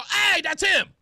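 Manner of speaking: high, loud spotting cry; excited call out. Retold or imitated. Fan on the street calls out to a celebrity, excited and loud. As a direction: call out like you just spotted a star.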